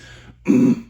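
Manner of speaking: rattling held throat grunt. Shown as a demonstration. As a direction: hold the chest-clearing grunt. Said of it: "hold that grunt".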